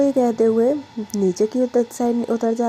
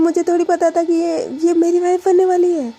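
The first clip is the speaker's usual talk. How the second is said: higher and louder, defensive outburst; loud protest; 8 semitones higher, 5 dB louder than usual. Acted. Pragya snaps back at her grandmother, loud protest. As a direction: push back hard at grandma.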